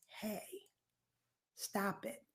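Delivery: understated, hushed voice of self-doubt; little inner voice. Acted. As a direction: quietly voice the doubt in someone's head. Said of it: "that actually speaks to you and says".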